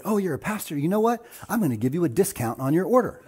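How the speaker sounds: lightly voiced sweet lady, pitched high and delighted; a touch of the cashier's high delight. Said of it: "And so she said".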